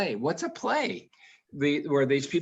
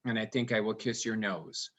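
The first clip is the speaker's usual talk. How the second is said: low, level, emotionless delivery; fond words droned without inflection; affection in monotone; 4 semitones lower, 6 dB quieter than usual. Shown as a demonstration. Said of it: "Do our voices always sound like this".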